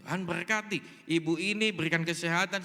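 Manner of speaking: loud, rote blessing; template prayer. Retold or imitated. Pastor rattles off a stock blessing for the old woman, mock template prayer. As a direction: imitate a stock prayer, projected loudly. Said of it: "Saya berdoa itu template".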